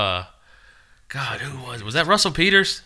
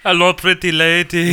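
high, louder mock Indian accent; 6 semitones higher, 4 dB louder than usual. Teasing about gaining listeners in India, mock Indian accent. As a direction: put on a playful Indian accent. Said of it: "I guess I asked for that accent".

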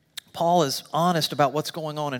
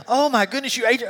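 higher-pitched mock yell; retold yelling; 7 semitones higher, 3 dB louder than usual. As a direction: imitate a wife's outraged outburst. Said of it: "She was, like, yelling".

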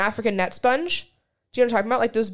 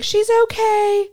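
high, loud, slow call to a passerby; calling out reassurance; 10 semitones higher, 5 dB louder, slower than usual. Retold or imitated. Driver reassuring a stranger on the street, loud call. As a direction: call out the window that all is fine. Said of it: "I had to be like".

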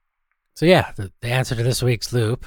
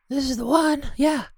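high silly sidekick voice. Acted. Sidekick voice continues.

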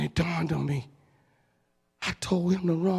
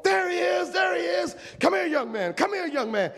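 excited preacher; 11 semitones higher than usual. Retold or imitated. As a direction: point him out to everyone. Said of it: "preacher spotting him".

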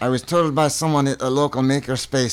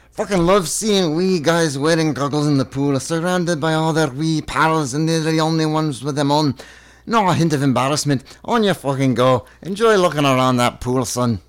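higher-pitched Scottish lilt; Scottish accent; 3 semitones higher than usual. Acted. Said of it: "This is the best accent work".

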